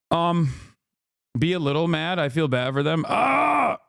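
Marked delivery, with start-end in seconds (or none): 3.1-3.8 sudden high fake shriek; high mock scream. Streamer mocks being upset with a high fake scream. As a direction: yelp as if in a fake tantrum. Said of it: "mock outburst".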